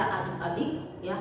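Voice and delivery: ordinary delivery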